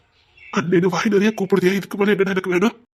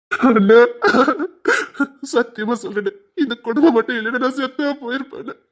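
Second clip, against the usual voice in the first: overwhelmed, weeping gratitude; crying; 3 semitones higher than usual. Acted. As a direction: swear it through sobs. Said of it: "நீ அழக்கூடாதுடா".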